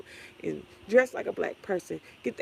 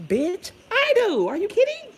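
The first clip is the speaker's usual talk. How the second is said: high, loud indignant comeback; indignant high-pitched squawk; incredulous retort; 12 semitones higher, 7 dB louder than usual. Retold or imitated. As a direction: exclaim it in mock outrage, voice shooting up. Acted retort to a commenter.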